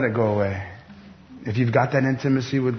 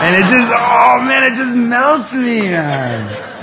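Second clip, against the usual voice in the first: much higher and louder, near a cry; overcome with emotion; 12 semitones higher, 6 dB louder, much slower than usual. Real, not acted. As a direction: let the joy break out. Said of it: "forgive me for being emotional".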